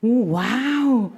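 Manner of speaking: loud, amazed exclamation; amazed wow. Retold or imitated. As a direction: gasp out a big wow at the sky. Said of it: "olhei pra cima e falei". (uau maravilhado e alto)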